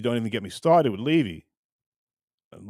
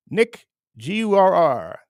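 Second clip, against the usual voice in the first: name spelled out slowly, louder; 5 semitones higher, 5 dB louder, slower than usual. Shown as a demonstration. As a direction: spell it out deliberately. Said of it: "Say my name quickly".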